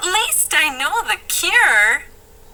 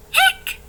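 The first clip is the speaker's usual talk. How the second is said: hiccup. Acted. [name] hiccuping.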